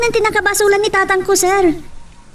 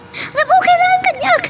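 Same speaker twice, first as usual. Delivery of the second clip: tearful high voice; 10 semitones higher, slower than usual. Acted. Wife hiding her hurt.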